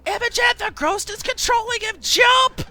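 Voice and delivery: high, loud urgent shout; full-voiced alarmed yell, building to the last word; shouted warning. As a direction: yell a desperate warning across the dock. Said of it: "Dwight shouts".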